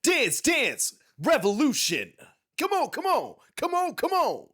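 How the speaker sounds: high, loud arcade announcer chant; high, loud hype man from the machine. Retold or imitated. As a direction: pump up the crowd like a game machine. Imitating DDR announcer hype.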